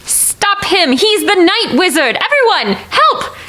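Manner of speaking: high, urgent cry to a crowd; calling for help. Acted. Player calls crowd to stop villain.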